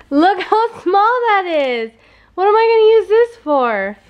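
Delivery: can't believe how small it is; surprised. Real, not acted. Mom amazed at the tiny hay bale.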